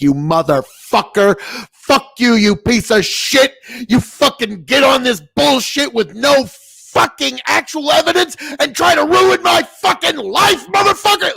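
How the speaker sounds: mock explosive tirade that climbs in pitch; phlegm flying anger. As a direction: act out the rant he held back, spitting mad. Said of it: "phlegm flying anger".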